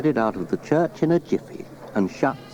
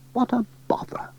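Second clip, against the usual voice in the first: hushed, breathy mild annoyance; whispered grumble; 13 semitones higher, 3 dB quieter than usual. A narrator's reading. Sarah grumbles about the cold fire, whispered. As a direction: whisper it like a small irritation.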